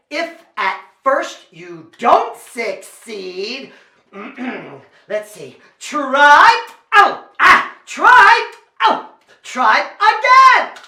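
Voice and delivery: comic struggle. Pretend. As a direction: shout it out, stretched, between failed bites. Acted struggle juggling while biting apple.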